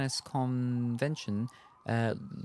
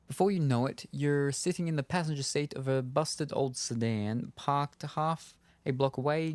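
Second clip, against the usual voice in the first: normal talking voice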